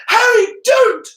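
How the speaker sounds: very high, loud panicked screech; frantic schoolgirl scream; shrieked. A narrator's reading. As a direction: shriek the plea, drawn out. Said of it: "screeched, shrieked Hermi-, Hermione".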